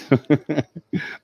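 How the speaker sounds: short high giggle. Real, not acted. Laughing at the joke about forgetting Beth, high giggle. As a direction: let a high laugh bubble out.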